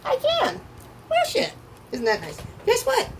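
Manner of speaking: squealing high, thrilled at the cards; giddy excitement. Real, not acted. Reader to Aquarius viewers, thrilled by a card standing up. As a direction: let the pitch jump up, delighted. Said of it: "I don't usually get this excited".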